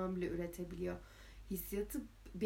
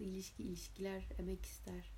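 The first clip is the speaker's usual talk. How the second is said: soft whisper, earnest; whispered sincere line; 8 dB quieter than usual. Shown as a demonstration. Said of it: "şaka bir yana doğru aslında".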